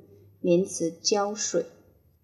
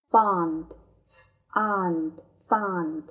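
fond said very slowly three times; slow drill; much slower than usual. Shown as a demonstration. Teacher drills fond slowly for the class. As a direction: stretch each fond out. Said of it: "teacher drills word slowly".

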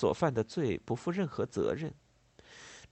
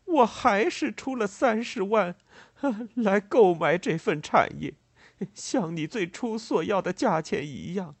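high, loud lament with choking breaks; acted choked-up despair; 9 semitones higher, 5 dB louder than usual. A narrator's reading. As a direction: wail it as if choking back sobs. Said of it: "他哽咽地说道".

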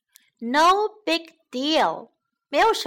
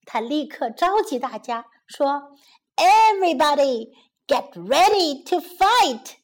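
plain reading voice

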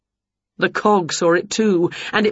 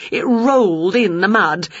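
plain reading voice

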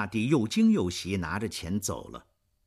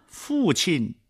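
high, louder cry of father; calling out; 9 semitones higher, 4 dB louder than usual. Acted. Cosette rushing to her dying father, high cry. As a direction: cry out to him in joy. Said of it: "科赛特喊着".

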